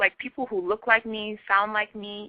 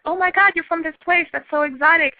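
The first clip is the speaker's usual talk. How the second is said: high-pitched imitation of a fawning admirer; mock the gross gushing, pitched way up; gushing, mock fascination; 10 semitones higher, 4 dB louder than usual. Guest imitates people fetishizing Nigerians, high mock gushing. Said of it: "they're doing it in, like, a gross".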